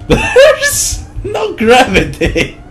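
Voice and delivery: very high, slow, squeaky giggling words; helpless high giggle while talking; laughing hard. Real, not acted. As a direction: squeeze the words out through laughter. Cracking up at game glitch.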